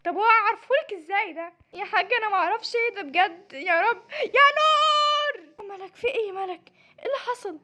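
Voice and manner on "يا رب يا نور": long, high, loud cry; yelling for help. Acted. Helpless trainee calls Nour to rescue her, drawn-out yell. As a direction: stretch the name into a desperate call.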